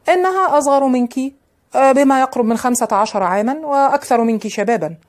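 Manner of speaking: mildly cruel retort, pitch lifted; understated harshness toward a discarded lover; harsh, cruel. A narrator's reading. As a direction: hit back with a touch of cruelty. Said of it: "فأجاب ليونارد بغلظة وقسوة".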